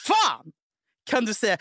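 high-pitched retold outrage, words set apart; indignant outburst, acted; flipping out. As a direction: spit out each word, incensed. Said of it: "då flippar jag".

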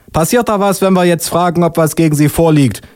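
high-pitched officious policewoman; high, pedantic official voice. Acted. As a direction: question a suspect in a high put-on voice.

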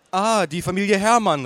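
waitress calling out. Acted. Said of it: "rief die Kellnerin".

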